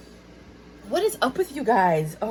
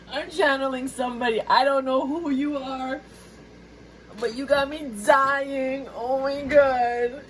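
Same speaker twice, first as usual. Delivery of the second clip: ordinary talk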